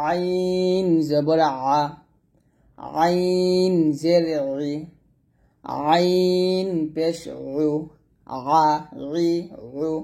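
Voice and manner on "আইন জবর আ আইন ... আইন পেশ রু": আইন জবর আ slow, pressed from the middle of the throat; ain with throat pressure. Shown as a demonstration. Teacher showing students the pressed Arabic ain. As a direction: squeeze it from the throat, slowly. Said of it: "আইন চাপ দিয়ে বলবেন".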